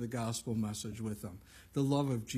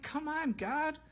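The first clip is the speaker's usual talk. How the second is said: exasperated plea; slower than usual. Retold or imitated.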